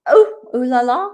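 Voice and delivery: playful mock-French tease; mock French ooh la la. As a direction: play up a cheeky French swoon. Mocking French flair.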